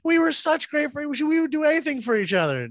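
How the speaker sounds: high, mock-sincere gush; sarcastic gush. S0 mocking fake grief posts, sarcastic high gush. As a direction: oversell the friendship sarcastically.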